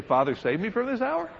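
high-pitched query, "hour" rising sharply; "this hour" pushed high as a question; questioning tune. Shown as a demonstration. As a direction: ask it incredulously, climbing right up on "hour". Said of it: "I put a little question mark".

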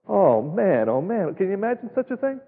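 normal talking voice

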